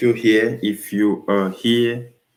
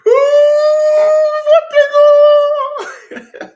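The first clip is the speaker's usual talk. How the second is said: much higher, louder, slow drawn-out yell with a laugh; long goal yell; 12 dB louder, slower than usual. Real, not acted. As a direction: stretch the yell out like a goal call. Live goal celebration.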